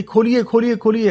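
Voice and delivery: put-on high, loud desperate pleading child; child pounding the door, pleading; begging child. Child begs family to open, panicked.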